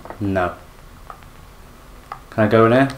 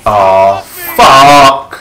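startled yell; 3 semitones lower, 8 dB louder than usual. Real, not acted. Chased by creature, startled yell.

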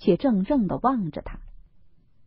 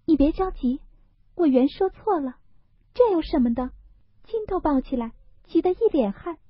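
put-on much higher, soothing girlish apology; hurried apologetic laugh; 9 semitones higher than usual. Daiyu to Baoyu, apologising quickly in a higher voice. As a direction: hurry to make it up, smiling. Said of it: "忙笑道".

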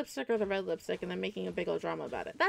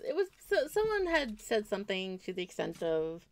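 normal talking voice

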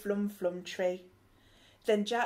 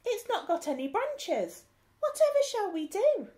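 high, louder worried wail; dismayed; 10 semitones higher, 3 dB louder than usual. Acted. Crew stuck below the branchless tree, worried wail. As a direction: rise into a fretful wail.

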